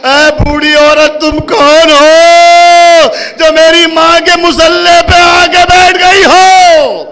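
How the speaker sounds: mourning wail; wailing cry. Acted. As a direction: cry out the question in grief. Preacher voices Zainab's wailing question.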